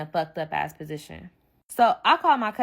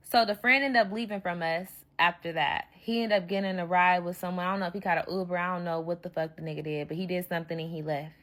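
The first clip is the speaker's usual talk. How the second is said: ordinary delivery, nothing marked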